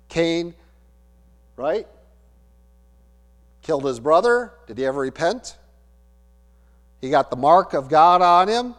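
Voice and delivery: usual speaking voice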